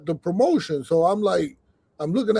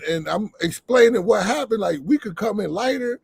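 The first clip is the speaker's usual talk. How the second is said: ordinary talk, nothing marked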